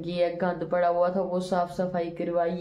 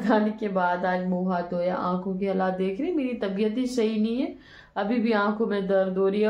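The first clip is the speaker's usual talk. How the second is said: ordinary talk, nothing marked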